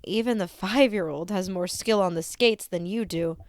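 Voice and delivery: ordinary delivery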